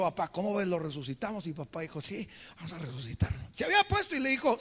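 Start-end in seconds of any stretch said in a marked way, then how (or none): 0.0-3.4 lower, soft playful asides; mock intimate chat. Preacher joking about Jesus consulting the Father, softly low. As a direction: whisper it like a private joke.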